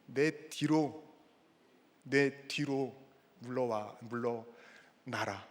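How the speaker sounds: low, soft call to step back; slow down and let it fade softly; quiet, patient command; gentle correction. Shown as a demonstration. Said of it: "뭐라 그러시는 거예요?".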